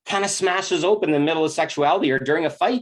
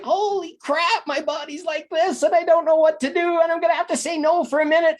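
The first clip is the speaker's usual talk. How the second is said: overwhelmed woman; 11 semitones higher than usual. Acted. Woman caught off guard by her body, high and panicky. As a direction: mimic a woman whose body is saying a hard no.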